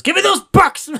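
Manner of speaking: very high, loud mock yell; shouted demand. Producer shrieking at Leo for the money. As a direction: grab for the money in a frenzy. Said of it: "Max ... goes".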